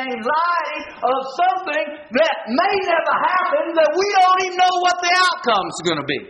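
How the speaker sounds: high, sustained pulpit cry; impassioned preacher's exhortation; full-force preaching. Real, not acted. Preacher applying the story forcefully.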